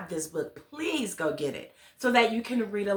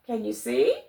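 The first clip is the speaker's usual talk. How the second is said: plain reading voice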